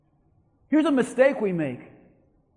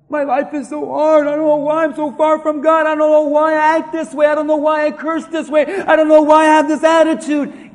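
loud, run-on mock lament; complaining rant; 3 semitones higher, 8 dB louder than usual. Preacher acting someone who doesn't know why life is hard, loud complaint. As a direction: pile up the complaints, building in volume.

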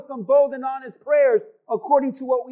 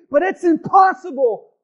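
despairing believer; 8 semitones higher, 9 dB louder than usual. Acted. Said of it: "voicing a doubting believer".

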